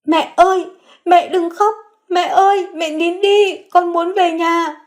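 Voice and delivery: put-on high child voice begging his crying mother; a little boy tugging at mom, wanting home; young son comforting his weeping mother, child voice; small boy pleading. A narrator's reading. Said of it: "Tiếng khóc dai dẳng yếu ớt".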